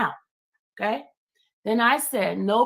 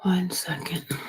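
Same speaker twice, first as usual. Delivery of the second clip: whisper. Real, not acted. Host whispering while setting stream.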